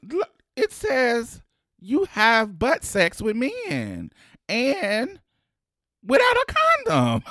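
high, loud, rising to a shout; mock nurse scolding. Nurse reading his results aloud, mocking, high and loud. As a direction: scold like the blunt nurse, building to a yell. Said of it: "looked down at her paper and said".

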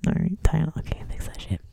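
whispered aside. Real, not acted. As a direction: slip it in under your breath.

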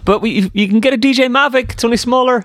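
high-pitched, hammed-up product announcer; mock launch pitch. Host counters at the mock launch, high announcer voice. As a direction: pitch the gadget in a put-on high voice.

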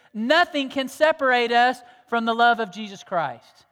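impassioned sermon climax; preaching at full force. Acted. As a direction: ring it out to the whole church.

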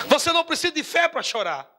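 ordinary talk, nothing marked

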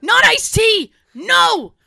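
high, loud, drawn-out scolding; hammed-up exasperated old man; Tony. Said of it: "He goes".

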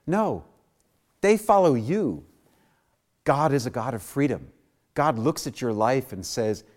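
ordinary talk

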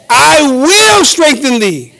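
high, slow, forceful scripture proclamation; impassioned, stretched-out Bible verse; preaching. Acted. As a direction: build to a ringing climax for the congregation.

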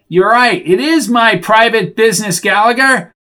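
high, loud indignant outburst; heated, high-pitched acted anger; angry retort. A narrator's reading. Red-faced Bricker retorts in quarrel.

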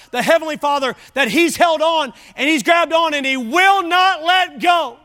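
high, loud shouted sermon; full-force preaching. Real, not acted.

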